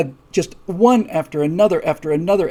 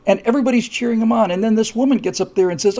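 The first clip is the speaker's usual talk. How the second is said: ordinary delivery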